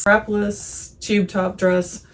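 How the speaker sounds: normal talking voice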